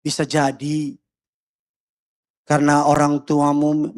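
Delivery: ordinary talk, nothing marked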